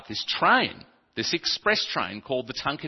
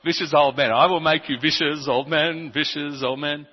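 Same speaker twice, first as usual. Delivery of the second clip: amused mishearing; 4 semitones higher, 6 dB louder than usual. Retold or imitated. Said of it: "Yeah, hilarious".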